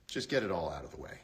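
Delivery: ordinary talk, nothing marked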